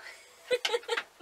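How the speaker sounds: high amused laugh. Real, not acted. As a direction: laugh at the dog's antics. Dog interrupts the video.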